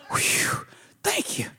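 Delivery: breathy whispered whew, thank you; relieved whisper; whispered relief. Retold or imitated. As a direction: sigh it out in relief. Relief after "no sex.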